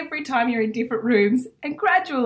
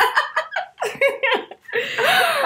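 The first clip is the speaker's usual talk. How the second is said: high, long peal of laughter; cracking up at the bit. Real, not acted. Laughing helplessly at her own police bit.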